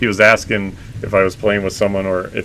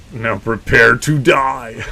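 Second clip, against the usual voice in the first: slow, drawn-out mock villain threat; mock menace, slow and theatrical; hammy villain; much slower than usual. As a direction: ham it up like a movie villain. Hammed villain line to teammate.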